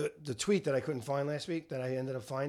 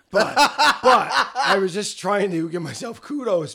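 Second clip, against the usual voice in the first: louder laughing explanation; 6 semitones higher, 11 dB louder than usual. Real, not acted. Dad laughing while explaining.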